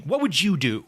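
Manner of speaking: half-shouted plea of frustration; mild mock shout. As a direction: imitate a frustrated shout, held back. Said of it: "he shouts at the chair".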